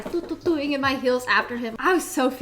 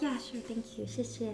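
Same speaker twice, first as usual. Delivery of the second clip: meek mumble; 13 dB quieter than usual. Retold or imitated. Awkward compliance at the table.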